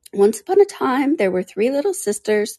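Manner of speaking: high little creature voice; lightly voiced dormouse starting a tale; hurried storyteller. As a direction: begin the tale in a small, high voice. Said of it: "began in a great hurry".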